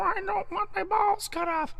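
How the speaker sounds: very high, soft cat voice pleading; voicing the cat. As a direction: beg like a little cat, way up high.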